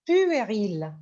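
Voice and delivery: usual speaking voice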